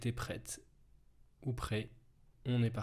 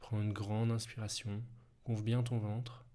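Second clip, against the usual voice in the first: right in your ear. Acted. Hypnosis relaxation, close and soft.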